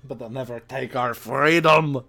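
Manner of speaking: a hammed-up Scottish movie-hero line; bad Scottish accent. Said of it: "That's the worst Scottish accent".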